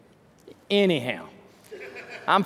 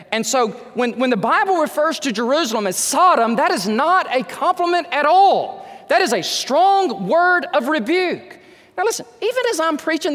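raised pitch, emphatic pulpit delivery; preaching rebuke; 7 semitones higher than usual. Real, not acted. Preacher warning the church about Jerusalem called Sodom, emphatic rebuke. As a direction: drive the rebuke home, pitched high.

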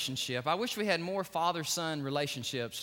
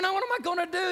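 scared; 8 dB louder, faster than usual. Retold or imitated. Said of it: "He's just scared out of his mind".

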